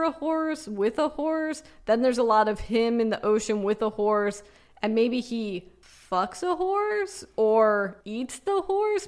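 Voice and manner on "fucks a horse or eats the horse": high, very slow disbelief; incredulous. Acted. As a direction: stretch it out in disbelief. Absurd ad description.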